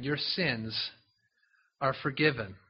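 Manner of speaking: soft, low and gentle; a quiet, tender pronouncement of forgiveness. Acted. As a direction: lay the words down softly like a blessing. Jesus tells the man again.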